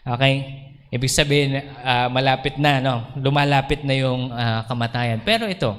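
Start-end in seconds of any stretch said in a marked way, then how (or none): none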